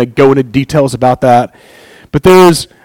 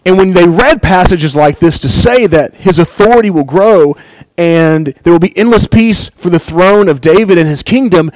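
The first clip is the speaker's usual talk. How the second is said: ordinary delivery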